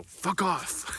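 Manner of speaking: lightly imitated annoyed passerby; gruff stranger. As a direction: toss off a curt dismissal as the stranger. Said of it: "I just hear this guy's voice go".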